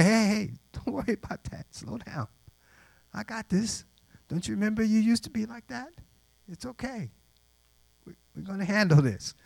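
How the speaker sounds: high, soft settling-down voice; hushed, soothing retelling; calming reassurance. Retold or imitated. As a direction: gently talk them down, acted out. Calms the boys down.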